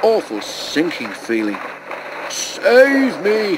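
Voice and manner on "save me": high, panicky splutter. A narrator's reading. Sinking Bulstrode splutters for help, high and panicky. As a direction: choke out a plea. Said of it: "he spluttered".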